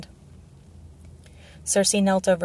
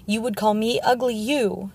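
higher, indignant outburst; enraged; 3 semitones higher than usual. A narrator's reading. Insulted knight confronts the dwarf, indignantly angry. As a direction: rise in outrage to a final "you". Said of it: "Sir Boros went purple with rage".

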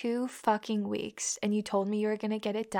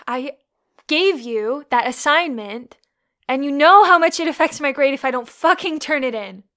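angry rant; 3 semitones higher, 10 dB louder than usual. Acted. Angry scolding over the assignment.

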